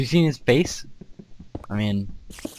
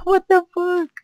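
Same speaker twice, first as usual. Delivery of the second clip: shocked; 4 dB louder than usual. Real, not acted. Reacting to explosion.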